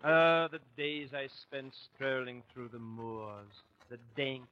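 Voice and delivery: put-on lightly done English country-gent accent; dreamy English squire with an English accent; mock English accent. [name] recalls English summers, light mock English accent. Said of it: "this dialect of yours".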